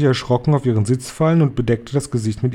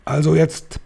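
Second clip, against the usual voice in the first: higher, sharp bark of command; barking witch; 6 semitones higher than usual. Acted. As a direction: snap it at the room like a drill sergeant. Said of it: "bellte die Hoch- und Großmeisterhexe".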